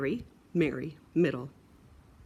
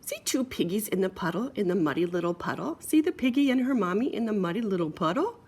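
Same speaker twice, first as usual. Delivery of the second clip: plain reading voice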